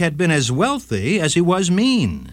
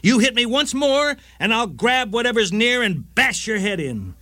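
high-pitched mock yell without real volume; narrated yell; 8 semitones higher than usual. A narrator's reading. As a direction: read it as a wife's angry threat, pitched well up. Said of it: "she yelled".

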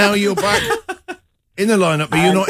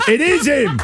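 high-pitched mock exclamation; receptionist's identification; 10 semitones higher than usual. Host plays receptionist picking out the culprit, high exclamation. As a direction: cry it out as a triumphant receptionist. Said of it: "unless that receptionist goes".

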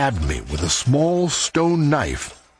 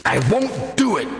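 higher-pitched, defiant outburst; defiant refusal; 11 semitones higher than usual. Acted. Friend refusing to abandon friends to the bandits, defiant. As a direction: refuse point-blank, pitch jumping up.

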